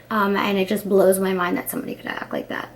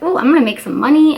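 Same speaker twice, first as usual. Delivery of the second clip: quick, louder, higher burst of excitement; excited inner voice; 5 semitones higher, 6 dB louder, faster than usual. Retold or imitated. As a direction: gush as if the paycheck is already yours. Retelling her excitement about the gig.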